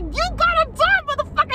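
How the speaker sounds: very high, shrieking curse at the bug; retold mock fury. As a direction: scream it while swatting. Still swatting the bug.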